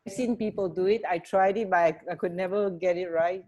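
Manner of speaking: ordinary delivery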